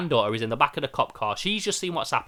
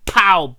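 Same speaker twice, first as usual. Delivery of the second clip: a sudden shouted impact noise; loud pow; 5 semitones higher, 11 dB louder than usual. Retold or imitated. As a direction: punch out the crash sound. Mimicking the car crash.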